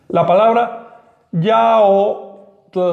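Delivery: "yaotl" drawn out very slowly, lateral tl; slow model word. Shown as a demonstration. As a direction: stretch yaotl to show the tl. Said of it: "Se pronuncia esa T L de esta manera". (yaotl muy lento, con tl lateral)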